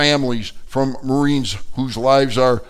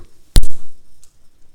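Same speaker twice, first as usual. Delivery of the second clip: labor groan; 14 dB louder than usual. Acted. Mother in labor.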